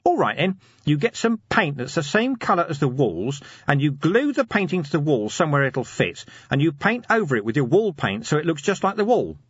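high-pitched, eager scheming corporal; eager schemer Nobby. Acted. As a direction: pitch a crafty plan, voice well up. Nobby explains his scheme.

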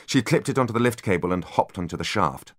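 plain reading voice

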